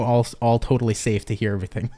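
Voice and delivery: ordinary talk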